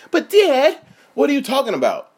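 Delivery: put-on very high whiny kid voice; surfer-dude Simba. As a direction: squeak it out like a spoiled surfer boy. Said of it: "And then he's like".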